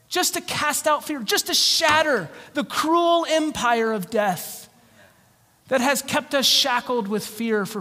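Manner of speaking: high, impassioned preacher's proclamation; fervent preaching. Real, not acted. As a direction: preach it with rising force, stretching key phrases. Preaches at full force over applause.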